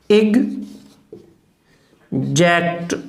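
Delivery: एग्जैक्ट drawn out very slowly, each sound stretched; slow demo word. Shown as a demonstration. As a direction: spell out एग्जैक्ट slowly like a teacher. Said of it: "unstressed रहेगा". (एग्जैक्ट को बहुत धीरे खींचकर बोलो)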